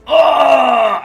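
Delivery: high loud fake scream of pain; hammed-up death cry. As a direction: overact being struck. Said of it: "You hit him, he goes".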